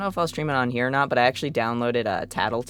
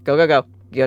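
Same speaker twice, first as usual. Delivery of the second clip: panicked yelling; 3 semitones higher, 10 dB louder, faster than usual. Real, not acted. Fleeing a monster in game.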